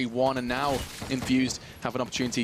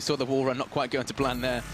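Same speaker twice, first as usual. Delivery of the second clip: ordinary talk, nothing marked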